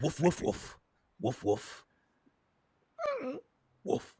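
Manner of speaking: deadpan imitation woofs; spoken dog talk. Game master as Yim speaking dog to animal, mock woofs. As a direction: woof in short bursts as if conversing. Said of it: "I speak, dog".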